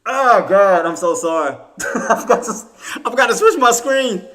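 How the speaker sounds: loud, high groans broken by laughter; real mortified exasperation; embarrassed wailing. Real, not acted. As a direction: wail your apology, laughing at yourself. Embarrassed groaning at own screen mistake.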